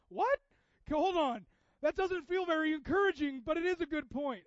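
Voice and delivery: loud, high mock indignation; startled protest. Listener answering Jesus being blunt, loud startled protest.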